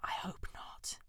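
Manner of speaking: a frightened whispered reply. A narrator's reading. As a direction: breathe it back without voice. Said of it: "She whispered back".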